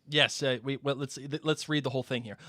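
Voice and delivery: ordinary talk, nothing marked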